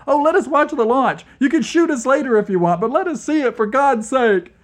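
high, loud, fast pleading babble; frantic babbling. A narrator's reading. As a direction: beg desperately, words tumbling out. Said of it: "Vlad began to babble".

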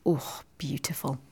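breathy, low whisper of delight; hushed admiration. Real, not acted. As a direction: swoon softly, almost voiceless. Swooning over favourite actor's voice.

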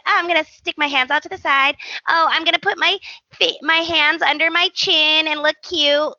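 playfully imitating a posing girl; cutesy mock voice. Imitating Brooke's cutesy thoughts.

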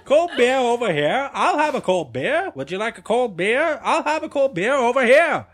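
stadium vendor. Acted. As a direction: work the crowd like a beer seller. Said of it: "selling stuff at sports games".

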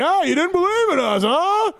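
high mocking whine; Jay Dungy voice. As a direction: sneer it in a squeaky voice. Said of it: "Jay Dungy".

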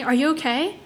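anxious, lifted question; urgent. A narrator's reading. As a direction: check on them urgently. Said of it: "I asked urgently".

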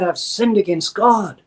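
slow, pleading confession of guilt; a touch of broken repentance, drawn out; mild mock lament; cried out. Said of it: "And David cried".

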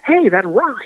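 ordinary talk, nothing marked

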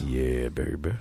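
mock sexy deep voice. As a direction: croon it like a lounge lover. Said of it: "You got that deep voice".

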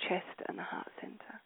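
breathy meditative whisper. Acted. Guide whispering to meditating listeners, hushed. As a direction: let the words dissolve into a whisper.

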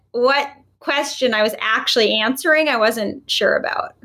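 ordinary talk

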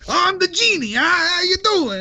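high, slow gruff little wiseguy; Danny DeVito voice. Retold or imitated. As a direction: play a short New York wiseguy genie. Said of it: "I want Danny DeVito to play the genie".

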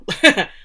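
quick burst of laughter; short laugh. Real, not acted. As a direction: laugh at the typo. Laughs at own misreading.

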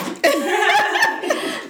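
high-pitched burst of laughter; cracking up hard; loud laughing fit. Real, not acted.

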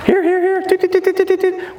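very high piping here and toots; whistle calls. Shown as a demonstration. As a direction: mimic a whistle, high and quick. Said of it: "Just tie it in".

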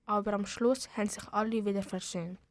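usual speaking voice

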